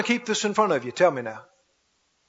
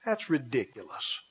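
mocking doubter; 8 semitones lower, 7 dB quieter than usual. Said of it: "That's why people say".

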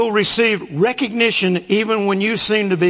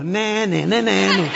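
playground taunt. Pretend. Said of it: "go to that other lady and go".

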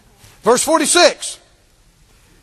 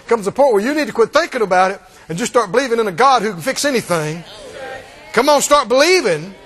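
ordinary talk, nothing marked

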